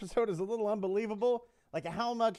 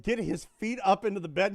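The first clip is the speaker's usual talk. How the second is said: ordinary talk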